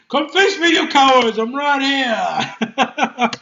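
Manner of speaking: loud high taunt, very slow at the end with a laugh; standoff challenge. Retold or imitated. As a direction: call the enemies out, half laughing.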